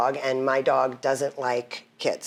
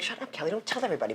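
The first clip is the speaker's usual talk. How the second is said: softer, fast, laughing self-scolding; mock self hush; 5 semitones higher, 8 dB quieter, much faster than usual. Scolding herself inside her head, quick soft laughing aside. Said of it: "and then I'm like".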